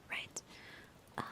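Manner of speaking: hushed, fully whispered stream chat; ASMR whisper. Real, not acted. Streamer thanks viewers and explains the script's backstory, ASMR whisper. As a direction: whisper to the listeners throughout.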